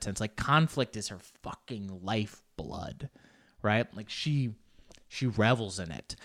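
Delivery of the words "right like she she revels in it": usual speaking voice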